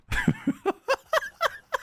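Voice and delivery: very high, rapid staccato laughter; high-pitched laugh. Real, not acted. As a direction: laugh helplessly in a high squeal. Laughing while under attack in game.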